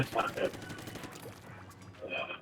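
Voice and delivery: normal talking voice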